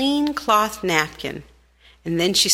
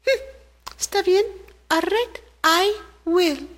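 much higher tiny ant voice, resolved; little ant agreeing; 9 semitones higher than usual. Acted. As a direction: chirp a determined okay as the little ant.

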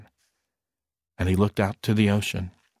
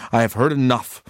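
plain reading voice

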